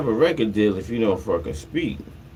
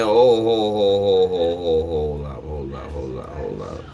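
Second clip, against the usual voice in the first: a stunned "hold up" that drains away to a near-mumble; hold up, fading; 4 semitones lower than usual. Retold or imitated. Stopping the network's cancel news.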